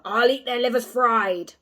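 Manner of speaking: slow, loud wolf relish; gloating wolf. A narrator's reading. Wolf gloating about eating the children, slow and loud. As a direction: savour every word like a hungry beast.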